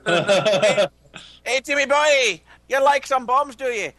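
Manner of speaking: high, loud hammed-up Irish accent; a put-on Irish accent, high and brassy; Irish accent impression. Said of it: "my Irish impression by watching Tommy Lee Jones".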